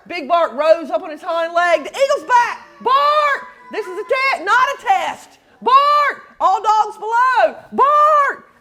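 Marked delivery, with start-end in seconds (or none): 1.8-8.5 high, loud alarm with barks; high watchdog alarm; alarm call. Acted. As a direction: sound the alarm, barking.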